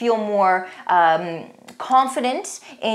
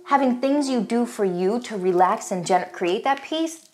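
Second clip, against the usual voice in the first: usual speaking voice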